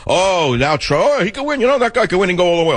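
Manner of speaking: high, fast mock gushing of the doubters; mocking turncoats. Host mocking the doubting Thomases now backing Trump, high gushing mockery. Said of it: "all the doubting Thomases who threw mud at him".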